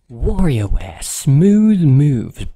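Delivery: big game-show hype shout; hype announcer shout. Acted.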